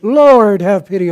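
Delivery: loud desperate cry of Lord; a mother's loud plea for help. A narrator's reading. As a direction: call out Lord at the top of the lungs. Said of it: "crying out at the top of her voice".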